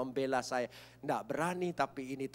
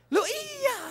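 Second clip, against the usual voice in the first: much higher and louder; high surprised cry; 8 dB louder than usual. Real, not acted. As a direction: jump up in mock surprise. Mock surprise in sermon.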